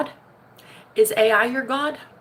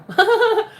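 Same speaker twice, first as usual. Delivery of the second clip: high laugh; 12 semitones higher, 5 dB louder than usual. Real, not acted.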